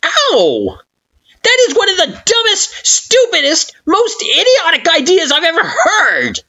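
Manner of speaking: high, loud furious rant; furious flower scolding; fuming scolding. Acted. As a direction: tear into them. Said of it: "Flowey scolded, absolutely fuming".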